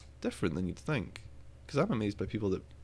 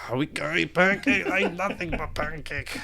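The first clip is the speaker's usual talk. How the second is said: put-on silly accent; 8 semitones higher, 5 dB louder than usual. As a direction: ham up a silly foreign voice. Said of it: "be like".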